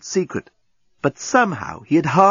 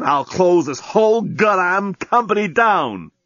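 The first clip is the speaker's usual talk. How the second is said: higher, slow furious threat; angry threat; 7 semitones higher, slower than usual. A narrator's reading. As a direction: threaten to shut it all down. Dan threatening the deskbot.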